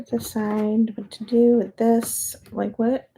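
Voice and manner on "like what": usual speaking voice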